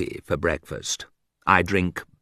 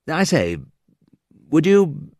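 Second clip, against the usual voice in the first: posh English proposal; 7 semitones higher than usual. Acted.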